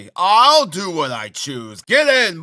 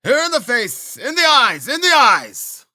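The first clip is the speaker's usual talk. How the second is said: high, loud urging; savage cry; 4 dB louder than usual. A narrator's reading. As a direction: egg the lads on, frenzied. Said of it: "cried Mokoka".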